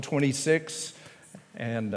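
ordinary talk

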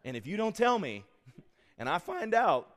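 mock threat. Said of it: "I'm like".

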